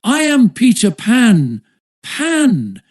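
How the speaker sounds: high triumphant cry. A narrator's reading. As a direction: announce yourself with a ringing cry. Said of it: "he cried".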